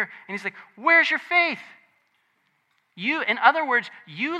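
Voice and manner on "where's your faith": high, loud challenging question; raised-voice reproach to cowering followers; sharp rebuke. Retold or imitated. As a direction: fire it out as a retold scolding. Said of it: "Jesus rebuked them".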